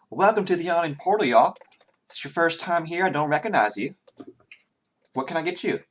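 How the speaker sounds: high, quick friendly barmaid; chirpy tavern barmaid greeting. Acted. GM voices the barmaid.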